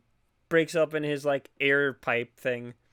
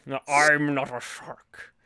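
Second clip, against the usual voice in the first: Richard Nixon voice; 2 semitones lower, slower than usual. Retold or imitated. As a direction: drag it out like Nixon. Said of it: "sound like Richard Nixon".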